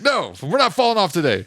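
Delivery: high-pitched mock-nervous refusal; alarmed parent. Dad retelling keeping his boys from the drop, mock alarm. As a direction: play up a dad's uneasy "no way".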